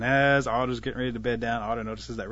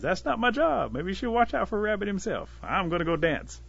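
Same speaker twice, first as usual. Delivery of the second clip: high-pitched, dismissive protest; high, evasive excuse; defensive brush-off; 8 semitones higher than usual. A narrator's reading. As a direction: wave the question away in a raised pitch. Disguised Rabbit dodging Wolf's questions.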